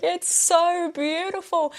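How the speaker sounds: high, slow, teary gushing; mock ugly crying. To herself over cookies, mock-weepy gushing. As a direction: gush through fake sobs, drawn out. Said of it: "I'm like".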